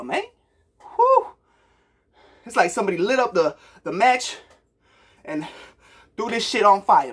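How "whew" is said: high loud whoo of delight; excited whoop. Real, not acted. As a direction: let out a hyped whew. Hyped reaction to song.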